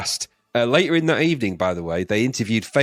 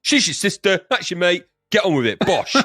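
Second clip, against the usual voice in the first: brusque, higher cockney geezer; angry East End Yoda; 5 semitones higher than usual. Acted. Yoda telling Luke about his sister, brusque East Ender. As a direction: tell him straight like an East Ender. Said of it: "angry sort of East End guy".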